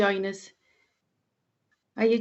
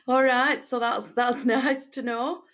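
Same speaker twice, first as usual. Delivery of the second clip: amused laughing; 3 semitones higher, slower than usual. Real, not acted. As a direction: talk through real laughter. Laughs at a chat comment.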